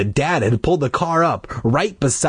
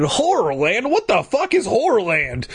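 higher, incredulous questioning; baffled outburst; 11 semitones higher than usual. A narrator's reading. Dad hearing of Horrorland, incredulous. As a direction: repeat the name in mock disbelief.

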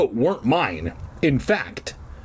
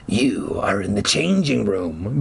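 low, solemn ghost-dog voice; ominous creature voice; 5 semitones lower than usual. A narrator's reading. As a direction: pronounce it low, like a sentence passed. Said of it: "the voice told us".

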